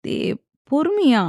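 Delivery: high-pitched, ticklish little whine; whining. A narrator's reading. Wife asks husband to go gently, whining. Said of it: "என்று சினுங்கினாள்".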